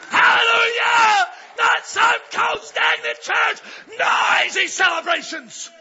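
high, loud, slow preacher's shout; fiery pulpit shout; preaching at full force. Real, not acted. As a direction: raise the roof on every word. Preaching climax on celebration.

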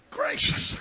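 high, soft unvoiced gasp; breathless gasp; gasped exclamation. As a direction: gasp it out from the heat. Exclaiming at the heat.

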